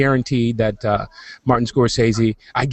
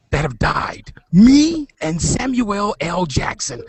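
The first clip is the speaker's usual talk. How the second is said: dramatic whisper; 6 semitones higher, slower than usual. Acted. Dramatic trivia reveal.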